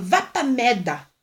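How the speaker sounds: put-on slow, drawn-out little-girl curse; toddler quoting a curse. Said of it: "Serena chegou em casa falando".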